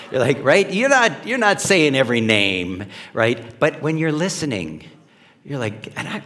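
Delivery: ordinary delivery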